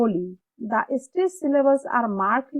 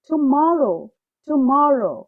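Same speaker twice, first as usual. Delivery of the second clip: Tomorrow with MOR loud and stretched; stressed second syllable; 5 dB louder, much slower than usual. Shown as a demonstration. Teacher drilling the word twice, loud stress on MOR. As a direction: punch MOR in Tomorrow, then fall. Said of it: "stress সহকারে পড়ব".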